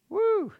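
high, loud woo; excited whoop. Real, not acted. Preacher whooping at the verse, excited. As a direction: let out a sudden whoop.

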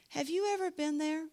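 ordinary talk, nothing marked

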